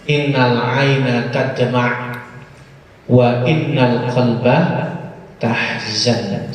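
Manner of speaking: solemn slow Arabic recitation. Acted. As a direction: recite the Arabic slowly and reverently.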